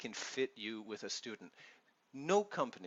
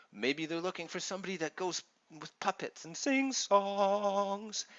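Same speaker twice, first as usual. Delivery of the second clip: put-on higher, louder sing-song British children's performer; mock kids' entertainer; 8 semitones higher, 6 dB louder than usual. Mocking the teacher families might want, hammed-up sing-song entertainer. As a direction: ham up a cheery puppet-show teacher voice. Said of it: "That's not me".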